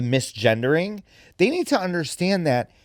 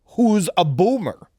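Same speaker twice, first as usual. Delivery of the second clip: ordinary delivery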